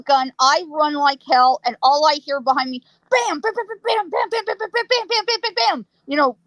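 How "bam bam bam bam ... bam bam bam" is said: high rapid-fire imitated gunshots; rapid gunfire. Teller recreating shots behind her, rapid high bursts. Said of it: "rapid gunfire".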